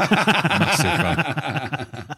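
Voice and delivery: laughing, high and drawn out; real laugh carrying the words. Real, not acted. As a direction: repeat it through real laughter. Laughing at own fandom claim.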